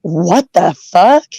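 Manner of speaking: slow, stretched-out disbelief; retold bafflement. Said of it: "and you go".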